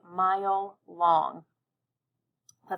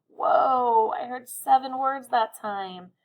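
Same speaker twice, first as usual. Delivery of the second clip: surprised; 5 semitones higher, 5 dB louder, slower than usual. Real, not acted. Teacher surprised by word count.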